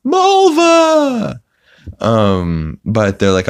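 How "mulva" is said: a triumphant high-pitched shout; high shouted name. Retold or imitated. Retelling a Seinfeld gag, shrieking the name up high. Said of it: "he's like".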